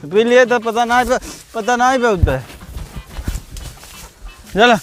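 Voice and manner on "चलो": ordinary talk, nothing marked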